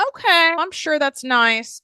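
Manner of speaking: high, brushing-off fake politeness; imitating a client politely shrugging it off; mock polite dismissal. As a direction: pat them on the head with a fake nice. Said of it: "It's gonna go".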